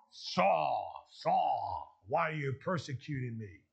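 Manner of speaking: low, drawn-out voice of God; compassionate. Acted. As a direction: call him by name low and slowly, with compassion. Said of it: "speaking in a very compassionate".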